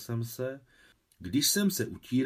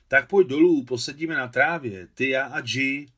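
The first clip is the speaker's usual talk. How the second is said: loud call to a giant above the clouds; 3 semitones higher, 9 dB louder than usual. Acted. Boy shouting up to Alexander in the clouds, loud calling. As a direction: shout it up to the sky, friendly.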